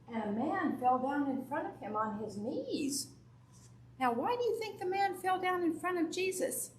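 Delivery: put-on bright, lively Bible story for kids; children's sermon story voice; storytelling to children. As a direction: tell it to the little ones in church, animated.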